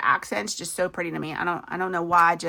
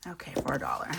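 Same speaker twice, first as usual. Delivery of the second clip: a disappointed little whisper to herself; breathy whisper of a tiny win; whispered aside; 9 dB quieter, much faster than usual. Real, not acted. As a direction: whisper it, much softer and lower. Aside after a small saving.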